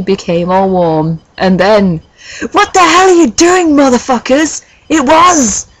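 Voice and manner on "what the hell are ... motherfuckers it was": high, booming theatrical yell; hammed-up outrage. Dumbledore catching the couple in the forest, hammed-up furious yell. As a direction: burst in with mock fury, high and far from your own voice. Acted outburst of interrupting Dumbledore.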